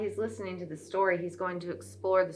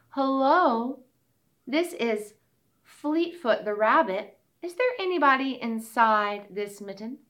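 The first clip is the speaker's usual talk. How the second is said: rabbit voice; 6 semitones higher, 6 dB louder than usual. Acted. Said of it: "said Fleetfoot".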